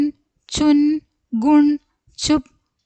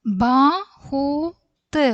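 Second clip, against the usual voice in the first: ബഹുത് drawn out slowly, syllable by syllable; slow syllable drill; 2 semitones higher, much slower than usual. Shown as a demonstration. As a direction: stretch ബഹുത് out for the class. Teacher drawn-out model word. (ബഹുത് പതുക്കെ നീട്ടി ഉച്ചരിക്കുന്നു)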